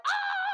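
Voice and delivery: short, very high, loud mock shriek; panicked scream. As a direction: shriek in comic overwhelm. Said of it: "And I'm like".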